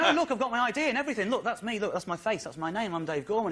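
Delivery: fast, high-pitched mock pleading; pleading protest. Turned-away visitor to man at club door, pleading. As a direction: plead your case in a hurried high voice.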